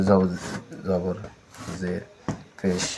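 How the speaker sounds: each sign name said slowly and apart, with pauses; teacher drilling names one at a time; slow drill recitation. Shown as a demonstration. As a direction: recite জবর, জের, পেশ one by one like a drill. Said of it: "teacher drills the three signs".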